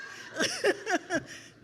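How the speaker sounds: high bright burst of laughter after the joke; amused laugh. Real, not acted. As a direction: laugh out at your own tease. Laughing at her own joke.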